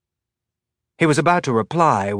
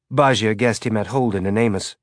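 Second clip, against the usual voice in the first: ordinary delivery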